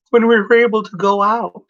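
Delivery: jumps up high, wistful; high wistful aside. Real, not acted. Wistful aside about pandemic.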